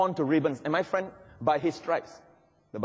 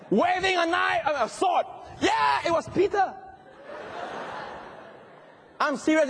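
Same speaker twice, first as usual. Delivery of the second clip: preaching at full force; 10 semitones higher than usual. Acted. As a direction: whip the church up, voice soaring.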